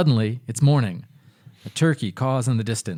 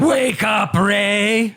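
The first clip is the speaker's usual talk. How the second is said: ghoulish voice; 7 semitones higher, 4 dB louder, much slower than usual. Acted. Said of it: "Is that better?".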